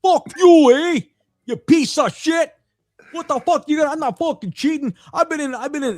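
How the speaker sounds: high, hammed-up angry Canadian accent. Canadian curler protesting cheating claim, exaggerated Canadian accent. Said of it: "And that Canadian accent".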